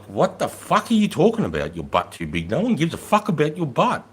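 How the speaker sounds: retold incredulous telling-off, pitch jumping high; mock-indignant dressing-down; exasperated scolding. Acted scolding of a junior officer.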